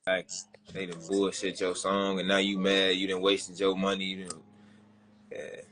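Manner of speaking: usual speaking voice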